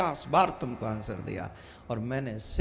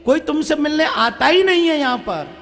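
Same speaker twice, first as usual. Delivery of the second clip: much higher, louder furious yelling at father; angry shouting; 13 semitones higher, 7 dB louder than usual. Acted. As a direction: blow up at the old man. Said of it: "वो चिल्लाने लगा". (गुस्से में चिल्लाना)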